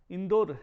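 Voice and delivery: इंदौर called out loud as the mistaken form; loud teacher demo of the incorrect इंदौर; wrong form of a word. Shown as a demonstration. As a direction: shout out the wrong इंदौर as an example. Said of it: "शब्द का उच्चारण".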